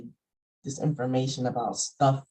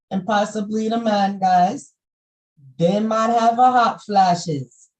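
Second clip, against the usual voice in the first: lightly Jamaican, raised and louder; Jamaican accent; 7 semitones higher, 8 dB louder than usual. Acted. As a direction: preach in a light Jamaican lilt. Loud Jamaican-tinged sermonizing.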